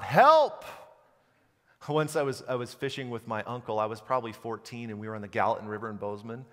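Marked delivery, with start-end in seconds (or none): 0.0-0.6 high, loud cry for help; calling for help. Pretend. Preacher acting out a desperate cry, loud call. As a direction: shout it like you're desperate.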